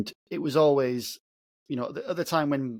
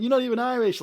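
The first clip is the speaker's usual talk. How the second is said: much higher-pitched mock protest; Peter Kay; 11 semitones higher, 4 dB louder than usual. Comic querying his mother's Irish accent, high mock protest. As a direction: act out a comic's indignant line, pitched right up. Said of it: "Where Peter Kay's like".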